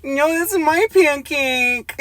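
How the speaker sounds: high, slow, silly quoted voice; high slowed meme voice; pancake reference voice. Retold or imitated. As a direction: drag out the quote in a high goofy pitch. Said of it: "if you wouldn't know the reference".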